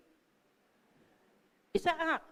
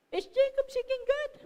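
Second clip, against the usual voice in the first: much higher, rising doubtful question; incredulous question; 12 semitones higher, 4 dB louder than usual. Shown as a demonstration. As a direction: ask it high-pitched, as if puzzled. Doubting question, then asked straight.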